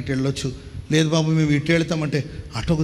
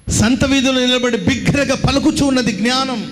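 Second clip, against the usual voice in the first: high-pitched, ringing declaration; preaching proclamation; 6 semitones higher, 4 dB louder than usual. Acted. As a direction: proclaim the verse to the whole street. (ఎత్తైన స్వరంతో వాక్య ప్రకటన)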